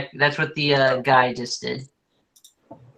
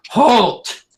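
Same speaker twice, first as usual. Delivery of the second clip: booming command from afar; loud booming voice; 10 semitones higher, 6 dB louder than usual. Acted. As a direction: boom it out to stop everyone. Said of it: "this loud booming voice that says".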